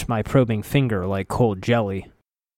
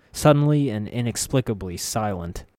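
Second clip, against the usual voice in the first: ordinary delivery, nothing marked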